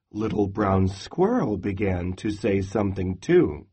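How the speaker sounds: ordinary delivery, nothing marked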